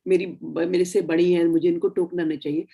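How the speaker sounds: ordinary talk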